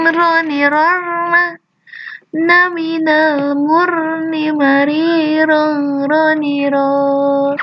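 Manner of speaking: slow chant, rolled r; teacher drilling the ra letter. Shown as a demonstration. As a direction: roll each ra slowly. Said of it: "Itu huruf ra".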